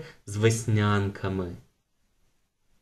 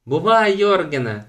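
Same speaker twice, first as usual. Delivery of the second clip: higher, louder farewell call; calling goodbye; 7 semitones higher, 5 dB louder than usual. A narrator's reading. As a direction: shout it after him eagerly. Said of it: "гукнула Туне". (голосно гукає на прощання)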